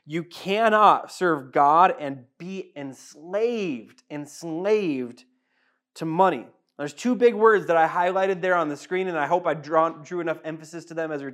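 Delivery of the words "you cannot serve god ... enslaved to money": "cannot" dragged out slowly; slow emphatic. Acted. Preaching to the church, slow and heavy. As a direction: hammer each word home slowly. Pastor stresses the key verse.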